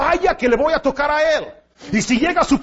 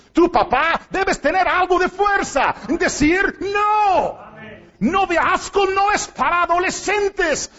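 preaching shout, the "No" pitched up high; 6 semitones higher than usual. Real, not acted. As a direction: hammer it home for the fathers. Preacher urging fathers to refuse. (grito enfático de predicador, el "No" muy agudo)

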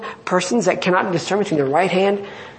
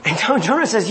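normal talking voice

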